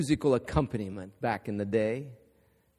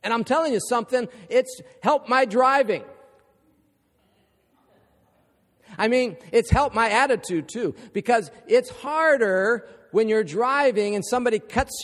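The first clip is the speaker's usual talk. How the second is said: higher, louder, fast preaching that builds to the Word of God; rising pulpit fervor, driving toward the amen; preaching at full force; 8 semitones higher, 6 dB louder than usual. Acted.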